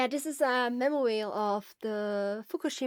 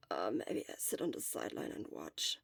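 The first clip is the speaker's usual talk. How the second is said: weak whisper; 14 semitones lower, 7 dB quieter than usual. Acted. Sick boy, hoarse and weak.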